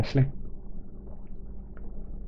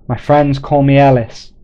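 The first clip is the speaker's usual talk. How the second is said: loud call, somewhat higher; shouting; 4 semitones higher, 8 dB louder than usual. Acted. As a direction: shout the name back across the distance. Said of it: "he shouted".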